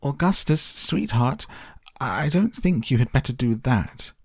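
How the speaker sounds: high-pitched mother voice; fussing mother calling out. A narrator's reading. Mother warning her son at the river, high and fussing. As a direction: call high, coaxing a child away. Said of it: "shouted Missus Gloop".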